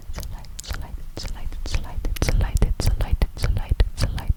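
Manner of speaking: ordinary delivery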